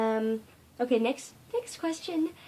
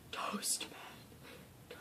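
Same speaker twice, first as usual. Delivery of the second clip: whisper; 15 dB quieter than usual. Real, not acted. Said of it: "whispered after loud line".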